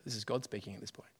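quick whisper to the listeners; whispered aside. Real, not acted. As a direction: drop to a breathy aside mid-reading. Whispered aside during reading.

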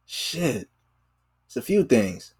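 ordinary talk

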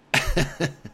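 high, loud burst of real laughter; can't stop laughing. Real, not acted. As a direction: let a big real laugh out. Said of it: "still laughing".

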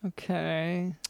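a doubtful, quiet okay; unconvinced, wary okay; skeptical. Real, not acted. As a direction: say it like you don't buy it. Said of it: "Doctor London still seems skeptical".

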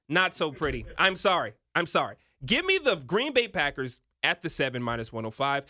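ordinary talk

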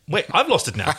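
plain reading voice

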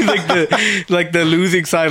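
ordinary delivery

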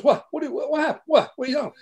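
high, startled repeated whats; baffled what. Retold or imitated. Corso to producer, high startled confusion. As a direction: act baffled at the apology request. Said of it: "he goes".

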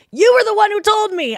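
indignant. Retold or imitated. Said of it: "And I'm like".

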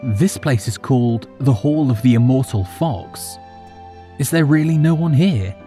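curious. A narrator's reading. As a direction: wonder aloud, speeding up at the end. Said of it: "merely asking with curiosity".

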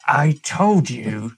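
slow, stretched-out mock dying words; drawn-out dying reproach. Stabbed husband to his wife on the grill, drawn-out mock reproach. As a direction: drag out each word as a hammed-up last reproach.

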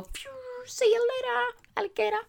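high, playful see-you-later sing-song; cheeky farewell. Acted. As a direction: toss off a jaunty goodbye. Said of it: "press the eject button and go".

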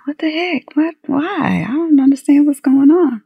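like the floor just dropped out; shocked disbelief. Retold or imitated. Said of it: "And I thought".

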